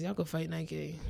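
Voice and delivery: ordinary talk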